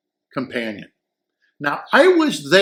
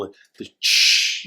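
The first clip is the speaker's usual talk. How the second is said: mimicked cymbal sizzle.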